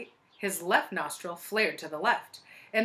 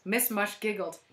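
ordinary delivery

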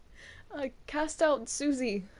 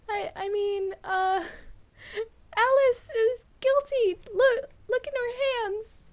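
high, loud, frantic; panicked accusation; 10 semitones higher, 7 dB louder than usual. Acted. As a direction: blurt it out in a panic. Frantic accusation in mock trial.

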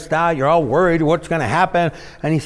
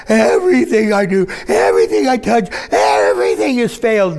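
hammed-up despair, raised and louder; mock wail of failure; dramatic wailing; 5 semitones higher, 3 dB louder than usual. Preacher acting out a despairing believer, hammed up. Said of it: "we get dramatic".

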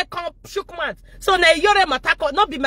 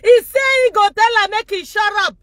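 high, loud call-out to the audience; gleeful mocking shout; 7 semitones higher, 3 dB louder than usual. Real, not acted. Vlogger mocks the clip to her viewers with a loud gleeful shout. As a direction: crow with glee that she got shut down.

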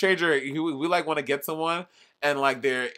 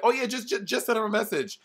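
breezy advice; 7 semitones higher than usual. Retold or imitated. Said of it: "people in your life just be like".

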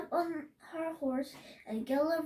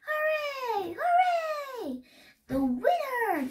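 very high cheer, growing louder; cheering crowd; 10 semitones higher, 6 dB louder, slower than usual. A narrator's reading. Crowd hailing the winner, rising jubilant cheer. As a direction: build the high hoorays into a loud cry. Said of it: "The crowd cheered".

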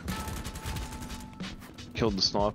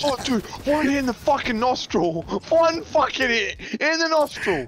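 shrill disbelieving yell, high and loud; high outraged yelling; 14 semitones higher, 3 dB louder than usual. Real, not acted. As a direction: howl in outrage at the hit. Outraged at in-game death.

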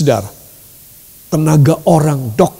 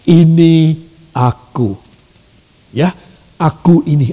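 voice of God; 3 semitones lower than usual. Acted. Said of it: "Dan Ia akan berkata".

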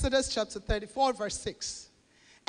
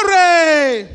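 very loud, ringing shout of praise; a pastor cries out in praise to his church; joyful cry; 4 semitones higher, 14 dB louder than usual. Real, not acted. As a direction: burst out in praise. Preacher's exclamation.

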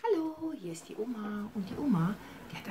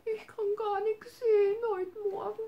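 sad little bunny. Acted. Bunny Hops tells grandma he feels sick, high crying whimper. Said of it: "so ganz traurig und sagte".

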